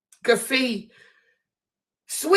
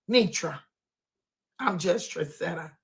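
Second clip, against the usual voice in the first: ordinary talk, nothing marked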